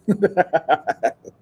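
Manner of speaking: high burst of laughter; amused laugh. Real, not acted. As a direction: crack up at your own joke. Laughs at her own impression.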